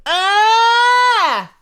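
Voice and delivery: high, loud, frustrated yell; sudden cry of defeat; startled shout. Real, not acted. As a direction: shriek at the screen. Dies in game.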